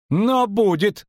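high-pitched plump halfling; high voice, drawn-out threat; grating halfling. A narrator's reading. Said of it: "скрежетнул толстенький низушек".

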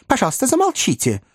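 high-pitched request for silence; interrupting. A narrator's reading. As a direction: cut in to silence him. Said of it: "перебил мистер Пиквик".

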